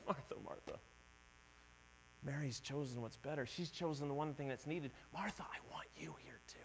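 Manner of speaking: tender. Retold or imitated. Jesus gently answering Martha, very soft and tender. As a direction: speak to her like soothing a friend. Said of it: "Very tenderly".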